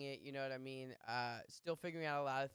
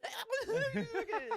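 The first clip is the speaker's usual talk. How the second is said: alien impression squeal; 6 dB louder than usual. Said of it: "do the best alien impression".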